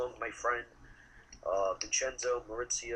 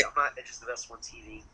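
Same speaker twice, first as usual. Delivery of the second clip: ordinary talk, nothing marked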